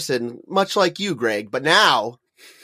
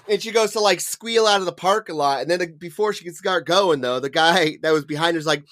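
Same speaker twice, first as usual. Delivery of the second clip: ordinary delivery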